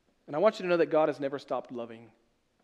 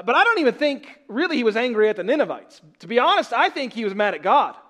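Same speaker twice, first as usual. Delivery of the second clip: loud, high, driving preaching; preaching at full force; 7 semitones higher, 8 dB louder than usual. Acted. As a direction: build the rebuke with mounting force. Preacher builds intensity.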